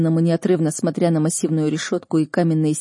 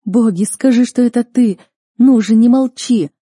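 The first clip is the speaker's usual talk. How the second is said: loud, higher, begging for an answer; desperate shouting; 5 semitones higher, 8 dB louder than usual. A narrator's reading. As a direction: cry out imploringly. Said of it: "закричала я". (громко, отчаянно умоляет)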